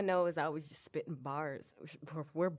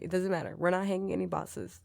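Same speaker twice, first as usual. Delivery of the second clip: usual speaking voice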